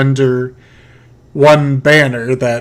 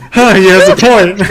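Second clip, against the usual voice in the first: much higher, louder words swept up in laughter; laughing; 4 dB louder than usual. Real, not acted. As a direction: burst out laughing as you concede. GM cracks up at player.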